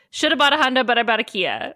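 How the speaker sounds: rapid mock tongues babble; fast gibberish tongues. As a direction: rattle it off fast like fake tongues. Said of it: "he just was like".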